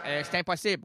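usual speaking voice

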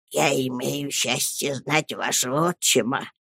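soft, low, near-voiceless tone; menacing whisper. A narrator's reading. Headmaster holding the boy by the ear, whispering. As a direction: speak hushed and low into his ear. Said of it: "стал шептать мистер Крикель".

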